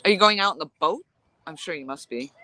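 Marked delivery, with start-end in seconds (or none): none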